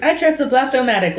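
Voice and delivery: high-pitched villain's henchman; high cartoon henchman voice; little henchman voice. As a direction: play the boss's little henchman, pitched well up. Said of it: "was a good little henchman voice".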